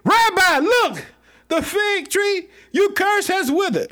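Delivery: Peter amazed. Retold or imitated. Peter showing Jesus the withered tree, high amazed call. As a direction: point it out in wonder. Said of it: "Peter remembered and said".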